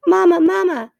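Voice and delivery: put-on high, loud baby gosling peep. A narrator's reading. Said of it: "peeped the gosling".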